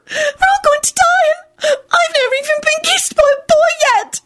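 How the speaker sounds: very high tearful wail; wretched sobbing. A narrator's reading. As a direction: wail it out through sobs. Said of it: "she sobbed wretchedly".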